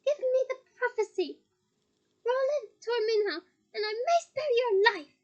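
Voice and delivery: high, loud, strained shouting demand; furious demand. Acted. Bellatrix demanding the prophecy, furious and loud. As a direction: scream the order with a cry in it.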